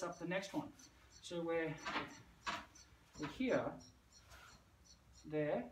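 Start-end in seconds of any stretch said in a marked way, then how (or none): none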